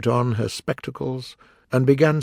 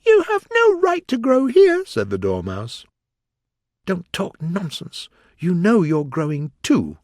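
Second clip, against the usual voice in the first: high, indignant little mouse; squeaky Dormouse voice; 7 semitones higher, 4 dB louder than usual. Acted. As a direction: scold her in a squeak. The Dormouse scolding.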